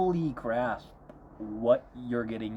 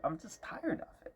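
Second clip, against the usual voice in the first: weary, soft; 5 semitones higher, 9 dB quieter than usual. Real, not acted. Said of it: "I'm tired".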